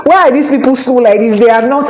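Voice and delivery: hammed-up exasperated Nigerian friend; half-joking loud grumble; mock-impatient friend. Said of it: "the guy is like".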